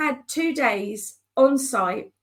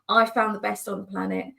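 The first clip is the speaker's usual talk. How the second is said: ordinary delivery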